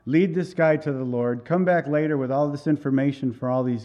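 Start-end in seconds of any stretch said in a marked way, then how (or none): none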